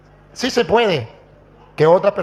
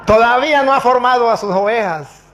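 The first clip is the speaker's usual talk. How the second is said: raised, louder mock reproach; imitated challenge; taunting pastor; 5 semitones higher, 5 dB louder than usual. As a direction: jeer at a rival in front of everyone. Mocking pastor confronts the priest.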